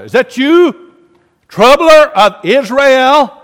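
loud, high, slow challenge; accusing shout. Acted. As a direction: call it out slowly, accusing. Acts Ahab accosting Elijah.

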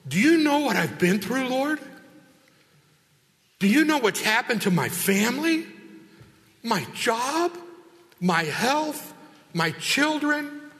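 loud, high-pitched protest with rising questions; complaining to God. Retold or imitated. Believer in trials protesting to the Lord, loud high indignant complaint. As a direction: hurl each question at God like a grievance. Angry complaining prayer voiced.

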